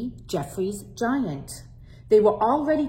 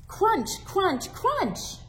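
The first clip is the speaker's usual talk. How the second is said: crunching sounds; 11 semitones higher, much slower than usual. Acted.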